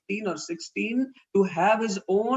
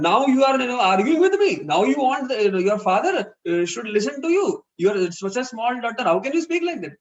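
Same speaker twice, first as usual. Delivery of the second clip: angrier father; 3 semitones higher, 4 dB louder than usual. Retold or imitated. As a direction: put the daughter in her place, furious. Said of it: "Then the parent becomes even more angry".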